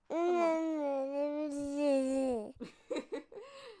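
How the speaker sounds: long delighted laugh. Real, not acted. Mother laughs at her baby's answer, delighted laugh.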